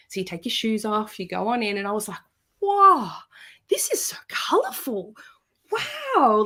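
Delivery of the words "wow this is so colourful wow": awestruck. Retold or imitated.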